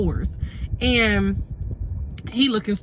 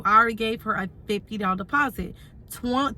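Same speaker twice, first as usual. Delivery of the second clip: usual speaking voice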